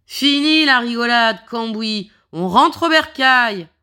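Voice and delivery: shouting. Acted. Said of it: "Ross se met à hurler".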